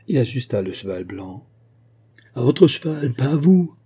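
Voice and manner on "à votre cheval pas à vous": loud, high shout; shouted warning. A narrator's reading. Musketeer shouting before he fires, shouted warning. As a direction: shout it across to the rider. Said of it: "cria-t-il à Fouquet".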